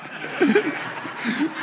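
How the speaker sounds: high burst of laughter; hearty laugh. Real, not acted. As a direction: laugh it off big. Laughs after prank.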